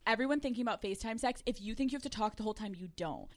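usual speaking voice

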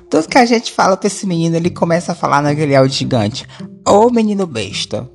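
imitação da tia em voz bem mais aguda; tia reclamando. Pretend. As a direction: auntie scolding her nephew, pitched well above own voice. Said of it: "Eu bem imagino ela falando isso". (tia dando bronca, voz aguda)

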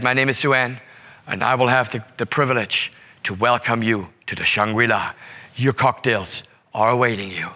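low, measured hotel host, imitated; gracious welcome. Front desk agent welcoming the parents, gracious and low.

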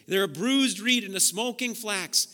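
loud, soaring preacher's call; preaching at full force. Acted. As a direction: preach it out to the whole church.

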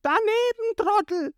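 very high-pitched jeering shout; taunting yell. A narrator's reading. Ruffy dodging Krieg's attack, high taunting yell. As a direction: yell it mockingly as you dodge. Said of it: "weicht ihm aus und schreit".

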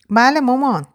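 put-on high child's voice; little boy. A narrator's reading. Boy answering his mother, high child voice. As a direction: answer in a small boy's high pitch. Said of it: "پسر بچهٔ هفت هشت سالهٔ توپلی".

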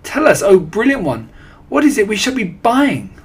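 high, loud pleading; adoring followers clamouring to know; eager cry. A narrator's reading. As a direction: beg the leader excitedly. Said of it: "they cried".

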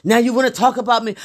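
high, fast stammering indignation; huffy husband. Retold or imitated. Mimicking her huffing husband, high and sputtering. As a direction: sputter like a man who feels attacked. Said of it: "he's huffing and puffing".

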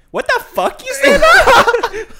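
very high, loud, slow squeal; a tough-looking guy squealing; squeaky high voice. Retold or imitated. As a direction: squeak it out in a tiny voice. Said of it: "you do not look like you sound like that".

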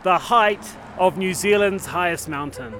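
slow, high mock newsreader; news anchor. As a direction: announce it like breaking news. Mock news read over music.

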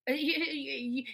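normal talking voice

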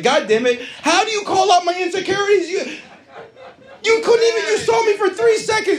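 high-pitched, building outrage; mock indignation. Answering the guy who noticed his tooth, mock indignant outburst. Acted retort about missing tooth.

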